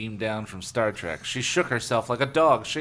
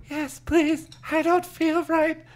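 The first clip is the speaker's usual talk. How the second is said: very high, thin character voice; faraway girl's plea; 4 dB louder, slower than usual. A narrator's reading. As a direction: plead as if drifting away. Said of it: "Aaron suddenly sounded far away".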